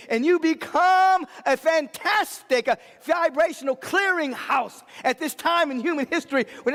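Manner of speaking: high, driving sermon cadence; rally the congregation, hammer each line home; fiery pulpit preaching; preaching at full force. Acted.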